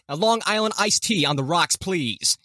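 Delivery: hammed-up broadcaster small talk; Jim Rome. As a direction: order a drink as if live on air. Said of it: "that's his radio voice".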